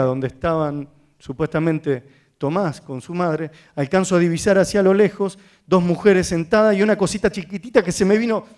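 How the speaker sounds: ordinary talk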